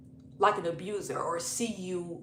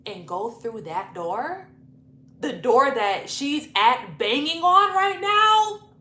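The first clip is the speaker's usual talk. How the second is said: slow, drawn-out disbelief climbing louder and higher; incredulous; 4 semitones higher, 8 dB louder, slower than usual. Retold or imitated. Guest refusing to walk past the banging girlfriend, incredulous. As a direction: stretch out a scandalized question. Incredulous reply to his order to leave.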